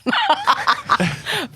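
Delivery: loud, high laughter. Real, not acted. Laughing to co-hosts about the smelly blanket. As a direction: laugh at her own silliness. Laughing at her own story.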